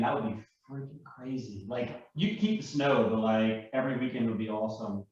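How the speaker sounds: normal talking voice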